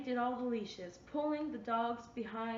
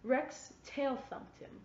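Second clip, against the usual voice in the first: plain reading voice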